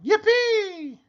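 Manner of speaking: very high, loud whoop; squealed cheer; crying out. A narrator's reading. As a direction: whoop it with glee. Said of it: "the T's cried out".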